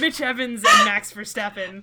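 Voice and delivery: loud. Real, not acted. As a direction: announce it with disgust. Announcing worse combo.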